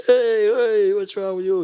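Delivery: very high, loud mock yell; angry drivers. Other drivers yell at student.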